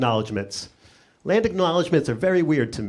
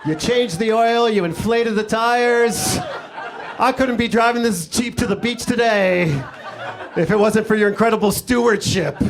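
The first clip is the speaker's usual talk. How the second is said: mock gracious acknowledgement; 6 semitones higher than usual. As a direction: lay the fake gratitude on thick. Mock acknowledgement to Jeep owner.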